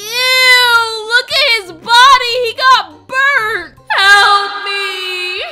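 loud, high horrified cries; disgusted panicked yelling. Acted. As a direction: yell in disgust and panic at the body.

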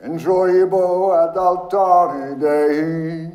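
loud, high, slow liturgical chant; intoned. A narrator's reading. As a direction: intone it like a priest at the altar. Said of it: "and intoned".

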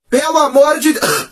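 high, slow gasping plea; mock desperation. Pupil trapped in stinking classroom, hammed-up desperate plea. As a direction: plead as if choking on a stench. Acting suffocation from the stench.

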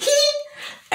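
very high silly squeal; mock high squeal. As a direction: let out a tiny silly shriek. Mock squeal after quote.

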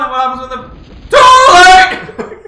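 very loud, high yell; laughing shout. Real, not acted. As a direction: shriek it out laughing.

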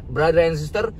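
ordinary delivery

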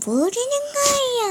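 high hammed-up sobbing wail; overplayed tearful echo; mock crying wail. Friend echoes mock wailing. (과장된 울먹이는 목소리)

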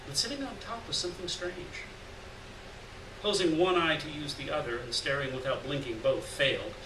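ordinary delivery

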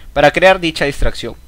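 ordinary delivery

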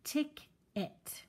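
teacher splitting ticket into syllables; split syllables. Shown as a demonstration. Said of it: "broken up the words into syllables".

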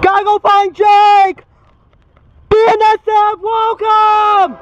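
high, loud, stretched-out shouting; excited yelling. Real, not acted. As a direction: holler it like a big announcement. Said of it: "I was yelling because I was so excited".

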